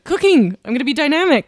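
hammed-up gung-ho enthusiasm; mock excitement. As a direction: announce it like a grand new life plan. Playfully declares new self.

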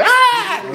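very high, loud falling-plane shriek; mock plane scream. Picturing a crazy death on a plane, mock shriek. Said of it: "be screaming from thirty thousand".

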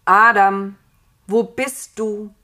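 God's loud, drawn-out call. A narrator's reading. God calling for Adam in Paradise, calling out. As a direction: call out loudly for someone out of sight. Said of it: "seine Rufe".

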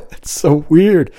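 high, slow, giggling words; laughing. Real, not acted. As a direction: say it still giggling. Laughs at own survey mistake.

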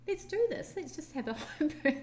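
high-pitched let's-do-this with a laugh; psyched-up resolve; excited decision. Retold or imitated. Mother recalling agreeing to home birth, excited.

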